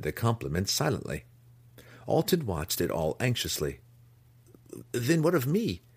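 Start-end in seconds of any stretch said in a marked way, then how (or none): 4.9-5.8 high, pleading question; anxious cry. A narrator's reading. Anxious impostor asks the matron his fate, crying out high. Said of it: "he cried".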